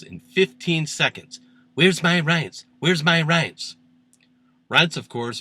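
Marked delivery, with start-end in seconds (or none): 1.7-3.8 slightly raised, repeated mock demand. Host quoting Trump's five-times repetition, mock demand. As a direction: toss it out as a higher mock call.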